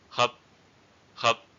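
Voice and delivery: "Hup" with a short vowel, final stop unreleased. Shown as a demonstration. Teacher giving an eighth-tone example, short "Hup". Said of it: "example with short vowels".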